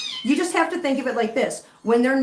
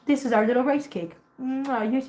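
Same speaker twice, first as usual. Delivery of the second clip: usual speaking voice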